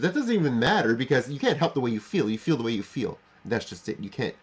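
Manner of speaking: usual speaking voice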